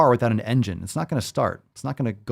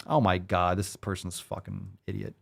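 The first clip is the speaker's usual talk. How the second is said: ordinary talk, nothing marked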